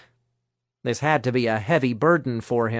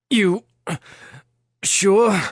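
higher, effortful grunt with a break between words; 6 semitones higher, 3 dB louder than usual. A narrator's reading. Thomas straining to hold both captives, grunting a question at Angela. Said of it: "Thomas grunted".

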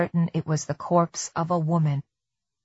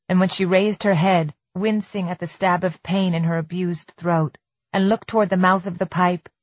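ordinary delivery, nothing marked

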